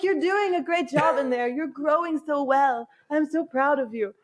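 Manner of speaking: put-on drunk friend praising the unborn baby, cooing; drunk baby talk to a belly. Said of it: "You're like".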